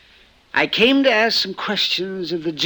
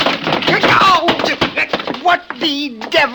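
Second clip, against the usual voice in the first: a sudden jolt of surprise, louder and higher; startled exclamation; 10 semitones higher, 5 dB louder, slower than usual. Acted. Man caught prowling, startled by the intruder. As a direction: caught off guard, blurt it out.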